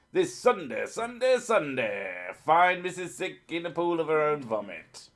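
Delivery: high-pitched hype-man advert voice; mock event announcer. Streamer parodies an event promo about Missus Sick, hyped announcer voice.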